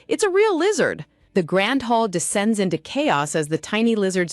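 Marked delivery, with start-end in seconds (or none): 0.1-1.0 high panicked shriek. A narrator's reading. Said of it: "a voice shrieks".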